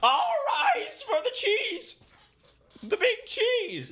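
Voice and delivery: high, slow, shouted herald call; grand entrance announcement. Pretend. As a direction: announce the royal arrival grandly. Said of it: "lifting on the Herald voice".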